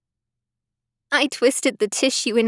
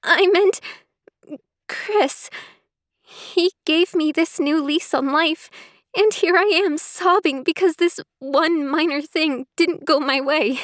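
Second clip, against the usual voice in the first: tearful; 6 semitones higher than usual. Acted. Emily tearfully explaining to Sarah.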